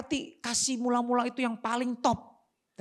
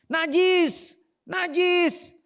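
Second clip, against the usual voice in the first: high, loud drawn-out cry; warning shout; 11 semitones higher, 7 dB louder, much slower than usual. Acted. Lepers warning people to keep away as they walk, shouting. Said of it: "harus teriak".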